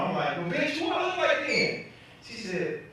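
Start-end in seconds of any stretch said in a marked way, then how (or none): 0.4-1.8 indignant retort. Retold or imitated.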